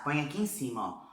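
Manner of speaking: ordinary talk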